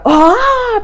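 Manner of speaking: sudden high yelp of a sitter; high, loud mock shriek; mock scream. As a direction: squeal it out like someone startled in a séance. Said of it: "så hold dog op med at skrige".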